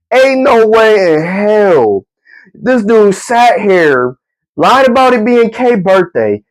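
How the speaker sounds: drawn-out, each word landed slowly; slow incredulous emphasis; slow disbelief. Retold or imitated. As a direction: spell out the absurdity word by word. Disbelief at friend's scheme.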